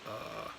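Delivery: soft, low "uh"; low "uh" at the bottom of the voice; low third tone. Shown as a demonstration. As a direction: let "uh" sink low with no rise. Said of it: "Just really focusing on going".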